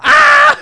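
very high, loud shriek; sudden mock scream of terror; terrified child's scream. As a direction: scream like a frightened little boy. Acting the boy's terror at Jaws theme.

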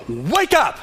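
high, emphatic call to rouse the church; sudden wake-up call. Retold or imitated. Preacher quotes Jesus to a sleepy church, sudden wake-up call. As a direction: call it out to jolt sleepers awake.

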